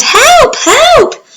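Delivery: high, loud cries for help; girl shouting for help; crying for help. Acted. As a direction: call out in panic.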